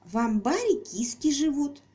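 put-on high, slow confiding child; little girl voice. A narrator's reading. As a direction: share a secret like a little girl. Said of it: "поведала Пупси".

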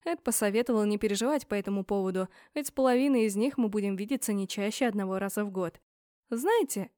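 usual speaking voice

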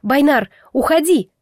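desperate shouted warning; strained shout. A narrator's reading. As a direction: call out with all your strength. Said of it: "крикнула я изо всех сил".

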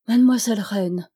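ordinary delivery

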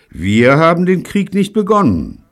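high, loud declaration; ringing denial; loud protest. Acted. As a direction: proclaim it with indignation. Neil protests in negotiation.

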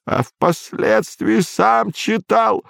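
put-on high, slow old man's voice; a frail old man insisting. A narrator's reading.

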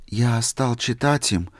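plain reading voice

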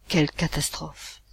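usual speaking voice

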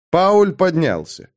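plain reading voice

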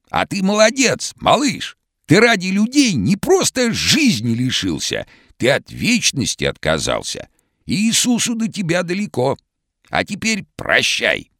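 hoarse, very high cartoon shout; vintage cartoon voice. A narrator's reading. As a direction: call out like an old cartoon character. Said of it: "хриплым, как в старом мультике".

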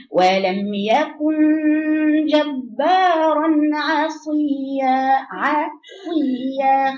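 عصيا with a middle عين, neither heavy nor thin; middle ain. Shown as a demonstration. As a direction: stretch عصيا, its عين balanced. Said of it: "يبقى هنا وأنا بقرأه". (عين وسط في عصيا)

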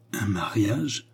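understated stunned question; a touch of disbelief; stunned stammer. A narrator's reading. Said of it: "balbutia André stupéfaite".

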